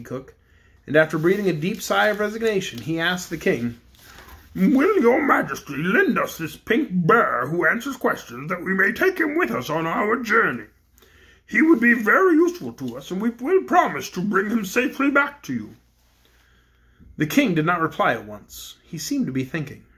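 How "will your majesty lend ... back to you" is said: the Frogman asking politely, in a changed voice. A narrator's reading. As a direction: Frogman asking the king for the pink bear, in his own voice.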